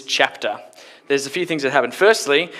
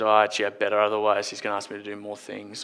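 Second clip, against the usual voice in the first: lower, trailing mutter to oneself; reluctant reflection; 5 semitones lower, 5 dB quieter, faster than usual. Acted. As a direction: think aloud, sinking into a soft mumble. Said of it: "he has a moment of reflection and goes".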